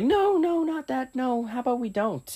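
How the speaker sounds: squeaky, high imitation of a teacher. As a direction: pitch way up, mock panicky teacher. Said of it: "he was like".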